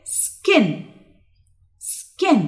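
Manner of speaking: "Skin" with a held s, then a short high "kin"; model pronunciation. Shown as a demonstration. Teacher asking learners to repeat after her, careful word drill. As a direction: drill "Skin" slowly for repetition. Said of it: "repeat after me".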